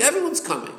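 teacher hammering home the answer Everyone's; emphatic answer. Shown as a demonstration. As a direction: lift Everyone's high to land the point. Teacher answering his own question.

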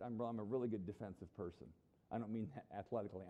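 normal talking voice

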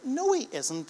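high, sharp indignant no; indignant denial. Acted. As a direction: contradict the friend in disbelief. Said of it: "the other one would say".

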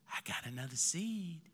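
softly murmured secret to a friend; whispered. Retold or imitated. Pastor telling Ryan quietly at church, hushed. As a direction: lean in and say it under your breath. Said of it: "whispered to Ryan".

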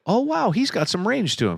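high-pitched pleasant surprise; impressed viewer. Retold or imitated. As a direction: act out being newly impressed.

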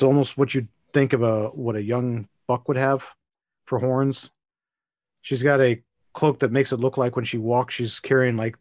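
ordinary talk, nothing marked

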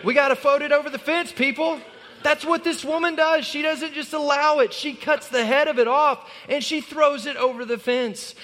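rally the congregation hard; fiery sermon climax; preaching at full force. Acted.

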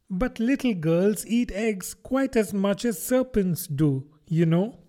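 put-on high, pleading child voice; young Alice protesting her innocence; earnest little girl. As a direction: reason politely but urgently. Alice argues with pigeon.

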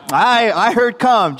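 comic, emphatic confirmation; acted chiming in. Disciples chime in, acted.

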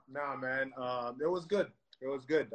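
ordinary talk, nothing marked

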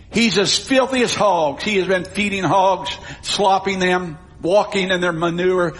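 ordinary talk

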